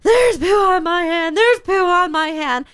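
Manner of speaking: put-on whimpering child. Son whimpering, retold.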